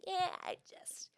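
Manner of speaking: high, drawn-out mock hesitation; reluctant brush-off. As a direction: imitate someone awkwardly wriggling out of it. Voicing dates turning her down.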